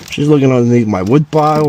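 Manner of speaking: ordinary talk, nothing marked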